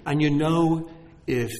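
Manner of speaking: ordinary talk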